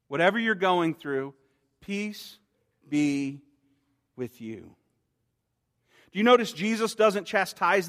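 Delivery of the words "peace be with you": tender. Acted. Repeats blessing to listeners.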